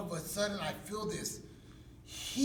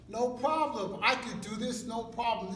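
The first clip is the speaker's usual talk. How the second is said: higher, louder show of bravado; mock confidence; 6 semitones higher, 6 dB louder than usual. Inmate reassuring himself in San Quentin, mock confidence. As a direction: put on a loud, higher self-assurance.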